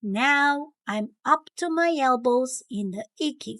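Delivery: ordinary delivery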